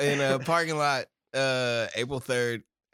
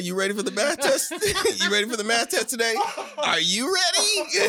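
laughing mock quiz; 5 semitones higher than usual. Mocking father quizzing son.

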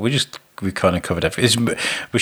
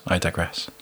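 mock aside; 4 semitones lower, 7 dB quieter than usual. Shown as a demonstration. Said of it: "I, I digress".

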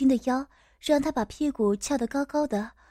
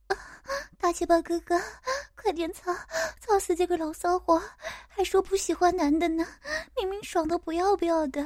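higher-pitched female character voice; daughter character; 6 semitones higher than usual. Acted. Daughter urging the narrator on, in a higher character voice.

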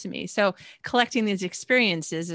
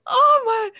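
"Oh my." said much higher and louder, a startled exclaim; surprised gasp; 9 dB louder than usual. Retold or imitated. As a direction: act out the shock of realising he's fighting tears. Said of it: "I was like".

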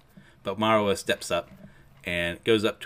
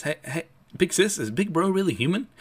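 much higher, put-on voice of a kid; astonished younger sibling; 9 semitones higher than usual. Ten asks big sis about Big Bro, astonished. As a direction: pitch it up high in disbelief.

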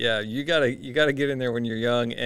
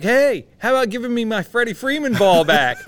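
loud, higher holler across the seats; retold holler, loud and high; 10 semitones higher, 9 dB louder than usual. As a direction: call out across the stands. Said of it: "So I holler over at him".

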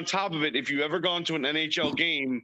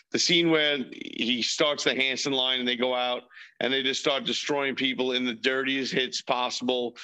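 ordinary talk, nothing marked

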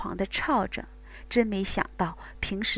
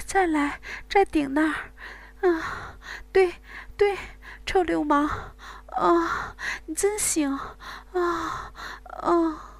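high whimpering, moaning female voice; whimpering moans; 7 semitones higher than usual. Acted. Bai Wei to Wang Gang, high whimpering moans. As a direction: whimper and moan, rising in intensity. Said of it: "呜咽着".

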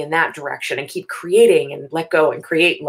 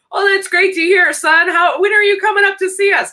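high, loud, oblivious cheer; deaf old grandpa; 12 semitones higher, 5 dB louder than usual. Retold or imitated. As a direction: beam obliviously like a grandpa who missed the bad news. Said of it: "never wore his hearing aids".

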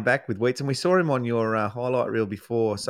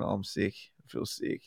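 imitating a sick mate, very soft and breathy; whiny sick complaint; 4 semitones lower, 11 dB quieter than usual. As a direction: whimper it like a sulking patient.

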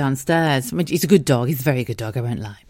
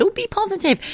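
mocking. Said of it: "I hate that word".

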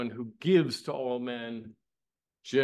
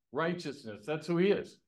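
ordinary delivery

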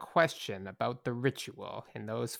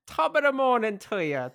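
hammed-up Irish brogue, pitched up; mock Irish accent; 10 semitones higher, 5 dB louder than usual. Signing off the podcast in a mock Irish accent. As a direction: put on a jokey Irish lilt. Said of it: "your perfect, uh, uh, Irish accent".

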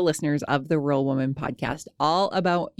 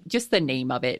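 usual speaking voice

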